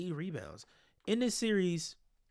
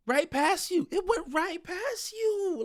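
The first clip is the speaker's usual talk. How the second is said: incredulous; 12 semitones higher, slower than usual. Real, not acted. Replaying defender getting beaten.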